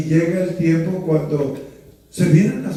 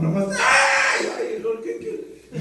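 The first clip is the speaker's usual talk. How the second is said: laughing mock cry; 7 semitones higher, slower than usual. Mocking a crying grown child.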